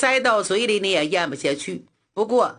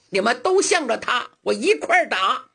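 usual speaking voice

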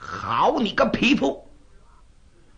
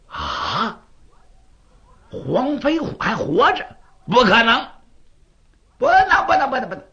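shocked disbelief; 7 semitones higher, 4 dB louder than usual. Acted. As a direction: refuse to believe he lives, shouting it down. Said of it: "气得一拨了脑袋".

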